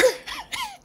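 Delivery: short high laugh of disbelief. Real, not acted. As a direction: laugh at the irony of it. Laughing at the irony.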